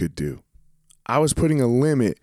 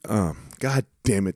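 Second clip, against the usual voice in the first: ordinary talk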